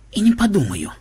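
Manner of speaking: ordinary delivery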